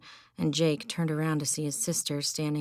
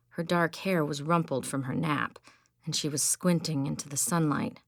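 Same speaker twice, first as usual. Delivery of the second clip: ordinary delivery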